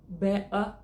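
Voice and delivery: better with the t dropped; swallowed t better. Shown as a demonstration. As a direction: say better with a glottal stop for the t. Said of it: "que eu acho particularmente muito feia". (better com o T engolido, quase só "bé")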